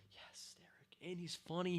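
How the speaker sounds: whispered "yes, Derek"; hushed fond aside; a secret fan whisper. Real, not acted. Quiet aside of admiration.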